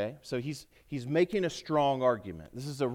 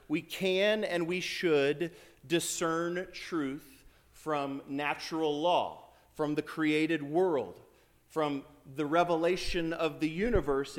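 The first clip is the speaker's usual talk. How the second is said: slow emphasis; slower than usual. Real, not acted. Emphatic slowed preaching.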